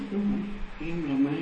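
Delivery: very hushed ghost voice; ghostly confession. Retold or imitated. Ghost in the wife reveals herself, hushed confession. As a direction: let it drop to a soft, eerie admission.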